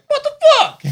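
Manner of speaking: high shocked yelp with a laugh; mock shocked. Man in leggings reacts to catcaller, high and shocked. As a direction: squeal it in mock alarm. King Batch reacts in Vine.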